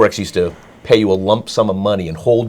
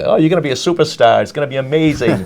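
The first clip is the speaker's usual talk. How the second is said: mock-enthusiastic, higher-pitched flattery; sarcastic praise; 6 semitones higher than usual. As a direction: lay on fake praise like a wise guy. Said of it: "I'm being a wise guy".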